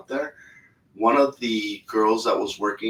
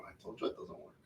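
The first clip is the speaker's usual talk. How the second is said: quiet exasperation; 21 dB quieter, faster than usual. Retold or imitated. Exasperated reply to attendant.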